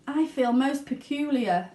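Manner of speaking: uneasy complaint; Violet. Acted. Violet tells her parents she feels odd, queasy. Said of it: "said Violet".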